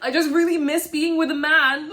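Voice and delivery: hammed-up sobbing confession; mock tearful whine. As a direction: imitate a crying man pleading. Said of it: "he's just like".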